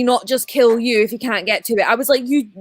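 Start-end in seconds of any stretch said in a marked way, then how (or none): none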